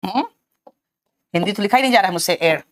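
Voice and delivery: ordinary talk, nothing marked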